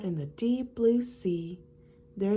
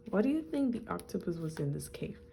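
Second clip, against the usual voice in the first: ordinary delivery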